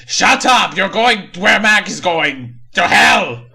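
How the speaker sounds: high, loud hammed-up threats; slow mock-evil bellow; villain shout. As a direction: yell like a cartoon demon. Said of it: "And Blue says".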